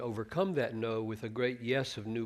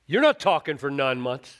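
angry angel; 9 dB louder than usual. Retold or imitated. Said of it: "He was mad".

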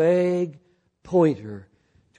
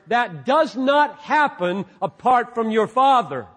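high, loud pulpit preaching; impassioned sermon delivery, higher and louder; fervent preaching; 6 semitones higher, 4 dB louder than usual. Acted. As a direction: drive the point home to the congregation. Preaching at full force.

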